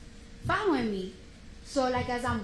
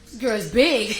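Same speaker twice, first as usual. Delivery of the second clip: 1 laughing; 10 dB louder, slower than usual. Real, not acted. Laughing remembering big guard.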